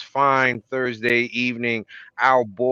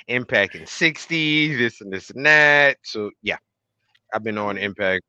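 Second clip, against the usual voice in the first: ordinary talk, nothing marked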